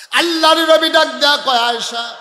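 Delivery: high, very loud preacher's call; crying out. Acted. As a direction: call out to Ayesha at full force. Preacher cries out the Prophet's call. (উঁচু গলায় জোরে ডাক)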